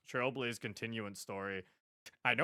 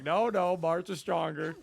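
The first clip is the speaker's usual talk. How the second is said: loud, raised mock rebuttal; insistent fans; 5 semitones higher, 7 dB louder, slower than usual. Streamer voicing rival fans in an argument, mock insistent. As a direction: imitate someone arguing back, slowing on the claim. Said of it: "people were like".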